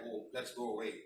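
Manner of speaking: soft, low mock retreat; scared retreat. Imitating scared souls leaving, soft and low. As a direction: let it drop low and soft. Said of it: "they get scared".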